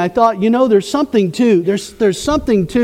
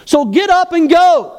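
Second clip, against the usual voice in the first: fervent exhortation; 7 semitones higher than usual. Real, not acted. Preacher urging congregation to act.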